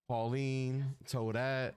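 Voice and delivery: ordinary delivery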